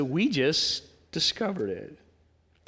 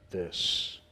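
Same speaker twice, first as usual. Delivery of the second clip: whisper; 11 semitones lower, 7 dB quieter than usual. Real, not acted. Closing point of the sermon.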